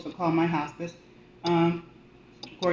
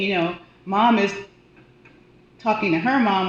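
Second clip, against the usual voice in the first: ordinary delivery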